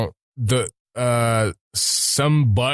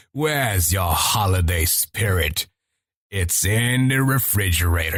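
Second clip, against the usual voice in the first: Reaper; 5 semitones lower than usual. Retold or imitated. Retelling own game-time imitation, low villain. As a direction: drop down and intone like a game villain. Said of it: "I was going like".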